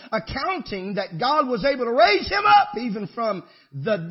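loud, high, ringing preacher's cry; preaching at full force. Real, not acted. Preacher lifting the congregation with a loud, high cry.

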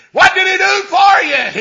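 high, loud call to the crowd; preacher calling out to the congregation; preacher's shout. Real, not acted. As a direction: throw the question at the pews.

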